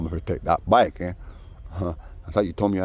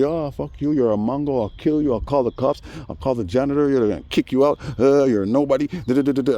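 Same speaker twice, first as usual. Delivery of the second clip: mock neighbor rant; 7 semitones higher, 3 dB louder than usual.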